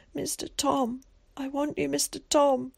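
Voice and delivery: put-on high, plaintive child's plea; tearful plea. A narrator's reading. Locked-up boy longs for his guardian, tearful. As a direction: plead for him, close to a sob. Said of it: "he gave a quiet sob".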